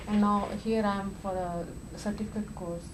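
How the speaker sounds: ordinary delivery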